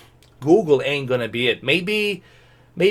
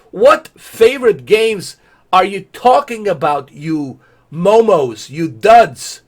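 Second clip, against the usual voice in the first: louder mocking rant; 4 semitones higher, 6 dB louder, slower than usual. Real, not acted. Yelling at Google about its ad, mocking rant. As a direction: berate them, getting louder.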